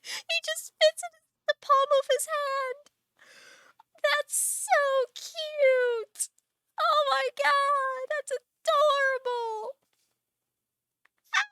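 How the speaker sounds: very high, loud wail of delight; drawn-out adoring squeal; overcome with cuteness. Real, not acted. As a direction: squeal slowly, near tears with delight. Gushing over tiny frog.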